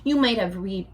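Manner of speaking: ordinary delivery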